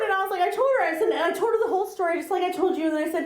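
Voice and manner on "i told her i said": usual speaking voice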